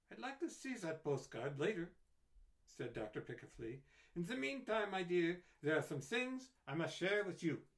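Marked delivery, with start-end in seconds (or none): 0.1-1.9 a touch higher doctor voice; calm, kind request; kindly doctor. A narrator's reading. 4.1-7.7 higher doctor voice, earnest. A narrator's reading.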